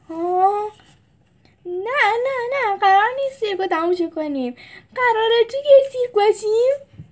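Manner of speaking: high, rushed, rising panic; a frightened kid realizing the truth; panicked stammer. A narrator's reading.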